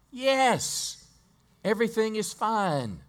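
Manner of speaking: high, bright-faced insistence that all is fine; mother in denial, insisting everything is fine to the prophet's servant; eerily calm mother. Acted. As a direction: play the grieving mother chirping that all is well. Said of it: "if somebody was talking like that to you".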